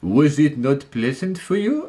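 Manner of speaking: slow, exaggerated French accent; a haughty Frenchman in a hammed-up French accent; absurd French accent. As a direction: drag out each word in a ridiculous French accent. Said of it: "the most absurd French accent".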